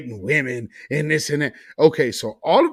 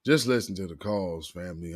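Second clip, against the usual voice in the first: ordinary talk, nothing marked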